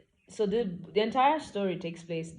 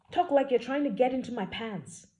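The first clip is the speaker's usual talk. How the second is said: exasperated retort; 8 semitones higher, 6 dB louder than usual. Acted. Exasperated heroine confronts hero.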